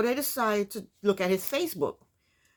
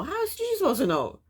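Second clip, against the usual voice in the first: higher, mock-fretting mother; mother's voice; 7 semitones higher than usual. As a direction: play the doubtful mother, pitched up. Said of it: "And the mother said".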